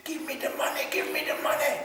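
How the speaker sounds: high, breathy hushed plea through a window; a laughing stage whisper; urgent whisper. Retold or imitated. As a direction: whisper it fast and secret. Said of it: "And he said".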